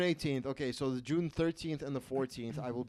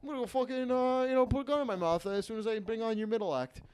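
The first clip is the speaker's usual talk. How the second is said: much higher, louder sing-song emcee babble; fake upbeat host; 9 semitones higher, 4 dB louder than usual. Comic acting out his emcee patter for friends, in a fake upbeat voice. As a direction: gush nonsense, then a bright despairing aside.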